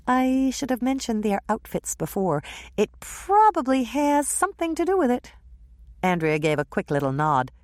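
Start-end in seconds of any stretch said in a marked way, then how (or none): 0.1-5.3 put-on higher, eager young woman; lightly voiced young woman. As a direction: play the chatty friend.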